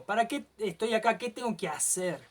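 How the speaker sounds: ordinary talk, nothing marked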